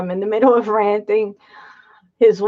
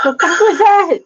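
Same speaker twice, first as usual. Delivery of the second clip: high, loud laughing question; cracking up in disbelief; 10 semitones higher, 5 dB louder than usual. Real, not acted. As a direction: laugh out your disbelief at the clip. Reacting to an odd clip.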